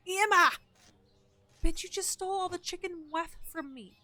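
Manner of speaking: high, loud shout of the name, then angry scold; mock yell. Player imitating her own yelling at a customer, mock angry shout. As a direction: yell at the thief like the neighbors hear. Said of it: "neighbors hear me up here going".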